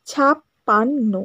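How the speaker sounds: ছাপ্পান্ন drawn out very slowly, beat by beat; slow syllable demo. Shown as a demonstration. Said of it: "আস্তে করে বলো".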